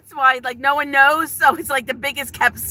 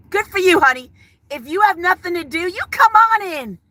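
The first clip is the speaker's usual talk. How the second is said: sarcastic welcome; 7 semitones higher than usual. Real, not acted. Sarcastic welcome to trolls in chat.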